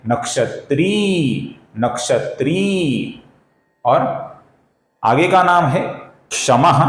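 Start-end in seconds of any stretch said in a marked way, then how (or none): 0.1-3.2 नक्षत्री with a long drawn ई at the end; slow, careful नक्षत्री with a stretched ई. Shown as a demonstration. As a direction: say नक्षत्री slowly, holding the final ई. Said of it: "इसका सही उच्चारण है".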